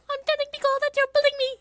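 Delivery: put-on very high, fast whiny girl voice. Miku tattling to Pico, very high and fast. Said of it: "It's Miku, it's Len, and it's Pico".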